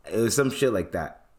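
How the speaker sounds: usual speaking voice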